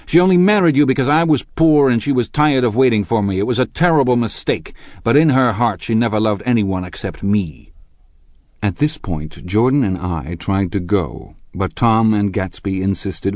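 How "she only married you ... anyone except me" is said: high, loud, impassioned outburst; vivid with excitement. A narrator's reading. Lover tells husband she never loved him, excited. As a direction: pour it out urgently. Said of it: "vivid with excitement".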